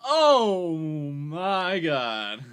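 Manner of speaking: very slow, loud oh my God; stretched-out amazed exclaim; awed surprise. Real, not acted. Toy triggers nostalgia.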